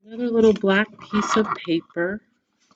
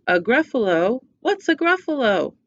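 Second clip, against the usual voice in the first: much higher, puzzled question; curious fox; 7 semitones higher than usual. Acted. The fox asking the mouse, high and puzzled. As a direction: ask it like a wide-eyed fox. Fox asks about Gruffalo.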